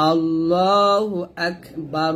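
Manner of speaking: slow আল্লাহু আকবার with a heavy lam; drawn out recitation; clear kaf. Shown as a demonstration. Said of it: "কাফটা হামজ করতে হবে".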